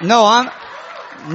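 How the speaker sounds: usual speaking voice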